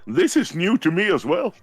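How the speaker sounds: high, hurried mock bewilderment; confused. Holomyon answering the creature's surprised look, confused. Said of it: "the same confused look back".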